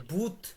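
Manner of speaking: ভূত said as the everyday Bengali word; sound demo. Shown as a demonstration. Teacher gives students a memory word, ভূত said clearly. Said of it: "আপনি এটা শব্দটা মাথায় রাখবেন কেমনে".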